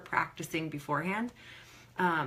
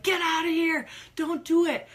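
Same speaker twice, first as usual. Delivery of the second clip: high, louder frantic plea; mock panic urging you to flee; imitating her body's alarm, pitched up; panicked inner voice; 9 semitones higher, 6 dB louder than usual. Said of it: "my whole body was like".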